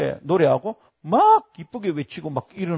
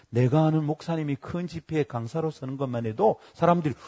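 normal talking voice

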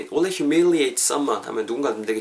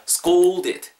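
"scolded" with the "ou" hit hard and long; punched OU sound; 3 semitones higher than usual. Shown as a demonstration. Teacher drills the oo sound, "scolded" with a strongly stressed, stretched "ou". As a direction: punch the middle vowel of "scolded". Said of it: "우, 울 때를 팍팍 치는 거예요".

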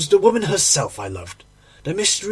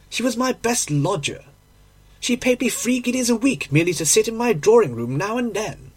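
higher-pitched, respectful landlady; 6 semitones higher than usual. Acted.

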